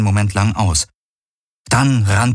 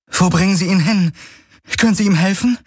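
much higher, anxious questions; worried heroine; 12 semitones higher, faster than usual. A narrator's reading. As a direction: heroine fearing for her friend.

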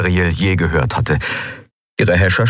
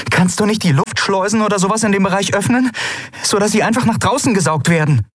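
high, anxious suggestion; urgent pleading; 12 semitones higher, faster than usual. Acted. Endriel begs Aylan to open the airlocks, urgent. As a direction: push the idea urgently in a high voice.

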